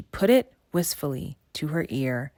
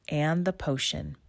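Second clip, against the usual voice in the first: plain reading voice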